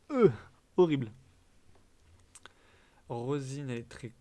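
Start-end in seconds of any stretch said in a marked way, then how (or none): 0.1-1.1 disgusted outburst. Real, not acted. Loudly condemning an ugly villager. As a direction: blurt it out much louder. Judging a villager she dislikes.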